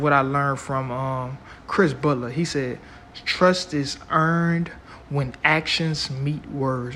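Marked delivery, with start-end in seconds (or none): 3.2-7.0 slow, weighty quote delivery; slow and deliberate. Shown as a demonstration. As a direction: spell it out slowly, word by word. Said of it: "I'm gonna say that again".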